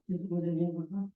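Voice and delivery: normal talking voice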